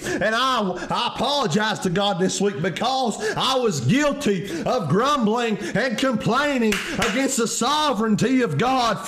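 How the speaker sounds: high, driving sermon delivery; impassioned preaching. Real, not acted. Preacher urging friends to trust that God is in control, impassioned. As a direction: preach it with fervor, pitched well up. Preacher builds to full force.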